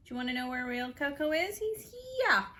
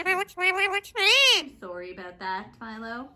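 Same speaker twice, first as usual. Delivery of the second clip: slow, louder sing-song; pet talk; 8 dB louder, slower than usual. Real, not acted. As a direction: stretch the apology out for the pet. Drawn-out pet voice.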